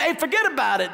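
a quick, hammed-up New York accent; a light put-on New York accent. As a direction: toss off a mock New York tough-guy line. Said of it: "a little New York accent".

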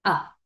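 brief open "a" as ah; short A sound. Shown as a demonstration. Teacher naming the short sound, brief "a". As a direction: cut "a" short, like ah. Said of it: "Un sonido corto".

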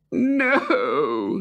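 high, tearful no; sobbed. Acted. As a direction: let the word break into a sob. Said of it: "sobbed the soldier".

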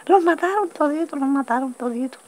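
high-pitched cry of alarm, re-enacted; imitated outcry. Musician bringing news of killings, imitated high outcry.

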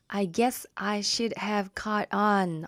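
slow careful example sentence; slow reading. Shown as a demonstration. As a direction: read slowly and clearly. Slow English example read.

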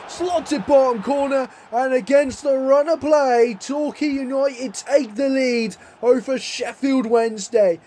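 rising goal commentary; excited goal call. Real, not acted. Goal call against run of play.

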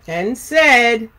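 ordinary delivery

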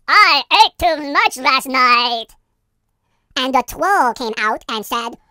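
ordinary talk

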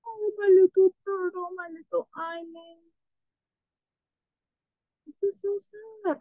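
pouty high-pitched lament; mock lament. Real, not acted. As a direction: mourn the little turtle in a high whine.

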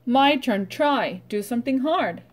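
ordinary delivery

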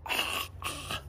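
voiceless rasping croaks; a sore throat from roaring; hoarse croak. A narrator's reading. Zog hoarse from roaring, whispered croak. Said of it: "his throat grew hoarse".

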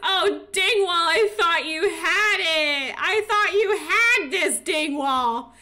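high, loud hammed-up lament for a losing sim; mock wail. Player mourning her beaten sim, hammed-up wailing. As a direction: wail over him like a tragic loss.

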